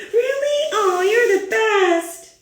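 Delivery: overjoyed by a gift, on the verge of tears; touched grape. Acted. Grape receives the scarf gift.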